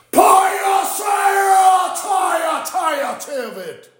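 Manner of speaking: very high, slow shouted call; drawn-out shout. Acted. Invoking the demonic world, long high shout.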